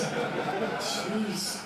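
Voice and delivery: ordinary talk, nothing marked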